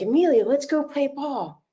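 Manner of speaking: put-on high, bright coaxing call to a child; coaxing grandson. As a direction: coax a little boy into playing.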